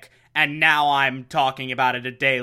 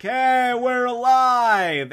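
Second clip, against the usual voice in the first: cheery heroes; 8 semitones higher, 3 dB louder, much slower than usual. Retold or imitated. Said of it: "are like".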